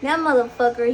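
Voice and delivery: usual speaking voice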